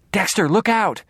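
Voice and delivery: high, loud urgent warning; warning cry. A narrator's reading. Sister warning Dexter of the gun, alarmed. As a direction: shout a sudden warning.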